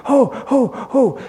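high breathy "oh, oh, oh"; eager gasps. Acted. Blind beggar waiting to shout to Jesus, eager. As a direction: gasp with rising anticipation. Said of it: "So he goes".

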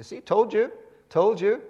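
high, loud gloating told-you; told-you Israelites. Retold or imitated. Israelites gloating at Moses, high and loud.

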